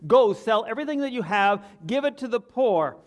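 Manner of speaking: put-on high and loud, Jesus commanding the young man; loud proclamation. Preacher voicing Jesus to the rich ruler, loud proclamation. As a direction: declare it with full force. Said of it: "and he says".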